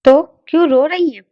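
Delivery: ज़ोर से और ऊँचे सुर में, घबराकर पूछो; बेचैन होकर ज़ोर से पूछता सवाल; घबराई ऊँची आवाज़. A narrator's reading. देवर भाभी को रोते देख घबराया. (रोती भाभी से घबराकर ज़ोर से पूछना)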